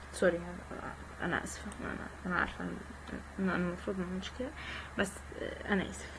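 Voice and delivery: soft, half-whispered sorry; quiet apology. Real, not acted. Gamer apologizing to viewers for mic breathing, soft and hushed. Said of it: "نفسي كده بيطلع وراه".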